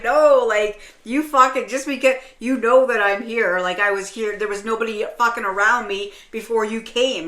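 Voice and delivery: loud, heated protest; retold anger. Woman retelling her checkout thoughts to a friend, mad. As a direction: fire it off loudly, indignant and insistent. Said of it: "I was getting mad".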